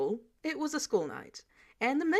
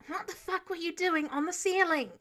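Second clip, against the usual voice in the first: baffled; 8 semitones higher than usual. Acted. Clint baffled question.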